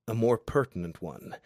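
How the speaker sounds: ordinary delivery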